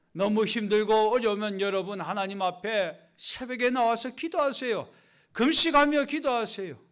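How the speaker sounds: loud, pressing call to pray; urgent exhortation. Acted. Urging the congregation to pray.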